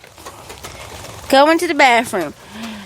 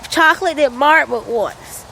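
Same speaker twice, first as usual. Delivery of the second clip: ordinary delivery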